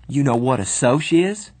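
put-on teen narrator answering the insult in anger; shaking with rage. A narrator's reading. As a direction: fire it back in rage. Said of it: "my voice shaking with rage".

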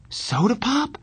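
high, rising bewildered question. A narrator's reading. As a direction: echo the name in puzzlement. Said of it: "I said, bewildered".

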